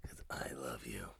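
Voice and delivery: soft unvoiced whisper, low. Father whispering to his kids, soft whisper. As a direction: breathe it to the kids tenderly.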